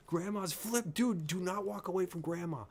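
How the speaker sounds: high, urgent plea to the screen; excited horror-viewer alarm; alarmed warning. Real, not acted.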